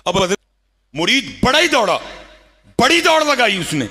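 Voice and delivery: high, loud rush of storytelling; excited preaching. Acted. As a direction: drive the tale to a peak.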